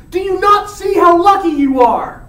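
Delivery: loud, high scolding shout; angry friar. Retold or imitated. Friar scolds ungrateful Romeo, shouting. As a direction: stress not as you shout. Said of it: "And the friar goes".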